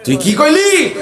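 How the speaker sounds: high, acted indignant shout; angry demonstration. Shown as a demonstration. Showing congregation how angry people talk back, high acted shout. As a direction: challenge them in put-on fury. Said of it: "আমরা যখন রাগি".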